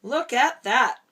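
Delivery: loud, bright exclamation; excited Rex. A narrator's reading. Dad reading, Rex marvels at the rocket excitedly. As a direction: call out in amazement.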